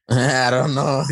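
slow, high laugh through the words. Real, not acted. Guest laughing at being asked to be a warrior. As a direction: laugh it off, unsure.

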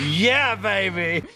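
high, drawn-out playful cheer; mock-excited, slow and high-pitched exclamation; playful whoop. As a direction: stretch it out high like a catchphrase. Jokey exclamation after co-host's remark.

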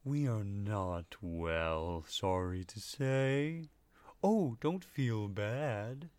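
very slow gentle creature voice; slow sad creature. Acted. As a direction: drawl out each word sadly. Flumps speaking.

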